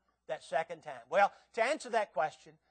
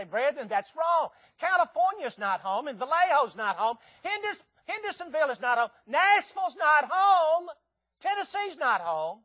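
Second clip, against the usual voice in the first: emphatic preaching; 8 semitones higher, 6 dB louder than usual. Acted. New Testament writers correcting the brethren about home, emphatic preaching.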